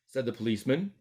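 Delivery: plain reading voice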